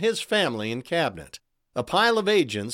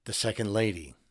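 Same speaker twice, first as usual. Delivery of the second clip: whisper; 5 semitones lower, 6 dB quieter than usual. A narrator's reading. Agent whispers the death.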